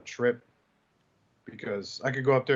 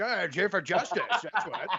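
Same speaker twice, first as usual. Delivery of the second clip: 1 laughing punchline; 5 semitones higher than usual. Pretend.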